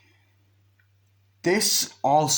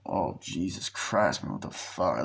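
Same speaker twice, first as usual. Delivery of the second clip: scared muttering; 5 semitones lower, 6 dB quieter than usual. Retold or imitated. Man followed by a car, muttering low and scared. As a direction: mutter it to yourself, spooked.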